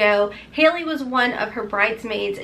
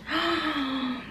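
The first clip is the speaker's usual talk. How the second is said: excited gasp; 3 semitones higher, 8 dB quieter than usual. Real, not acted. Sees a pack of five masks.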